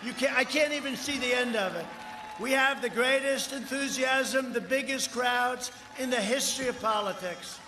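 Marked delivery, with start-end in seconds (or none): 0.0-5.7 higher and louder, calling over the crowd; excited rally shout. Real, not acted. Rally speaker shouting to the crowd about its size, excited. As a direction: boast to the crowd at full voice.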